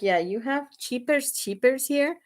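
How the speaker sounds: mock a heavy Spanish accent, turning Jeepers Creepers into Cheapers Cheapers; husband's bad Spanish accent. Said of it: "accent and he just can't pronounce stuff right".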